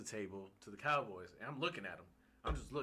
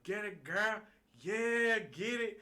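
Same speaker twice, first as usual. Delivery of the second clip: high, loud hype cheer; excited cheer; 10 semitones higher, 6 dB louder, slower than usual. Retold or imitated. Cheering on the dancing girl at the bar.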